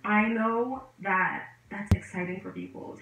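ordinary talk

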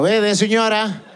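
ordinary delivery

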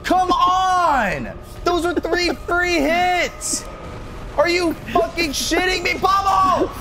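real exasperated yelling; frustrated shout. Real, not acted. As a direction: yell at your game character in frustration. Raging during losing boss fight.

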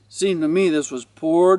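usual speaking voice

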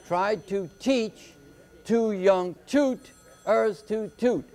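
put-on "tried to teach two young tooters to toot" slow, in measured triple beats; anapest scansion. Shown as a demonstration. Teacher demonstrating three anapests, slow beat-by-beat reading. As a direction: scan it slowly, weight on "teach" and the last "toot". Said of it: "Light, light, heavy".

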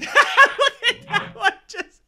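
high squeal through laughter; words breaking up in laughs; laughing hard. Real, not acted. Laughing at cat clip.

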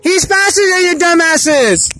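yelling. Real, not acted. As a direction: yell it across the field. Yelling at people chasing roadrunner.

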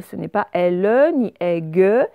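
normal talking voice

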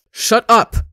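loud, high outburst; furious scream. A narrator's reading. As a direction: scream it to cut her off. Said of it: "Ranbu screams".

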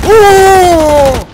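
sudden loud yelp of surprise; startled shout. Real, not acted. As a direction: yelp out in shock.

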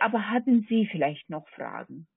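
normal talking voice

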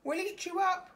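pleading monsters. A narrator's reading. Wild things begging Max not to go. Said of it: "But the wild things cried".

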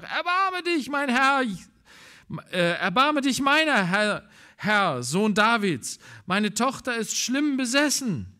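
high crying plea of a mother; desperate pleading woman. Acted. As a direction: plead with the Lord for your daughter. Canaanite woman crying for help.

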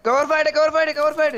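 high urgent call. Real, not acted. Knocked, calling for cover fire.

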